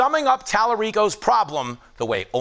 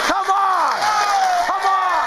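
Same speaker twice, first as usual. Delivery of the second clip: hyped shout; 10 semitones higher, much slower than usual. Real, not acted. Cheering own joke.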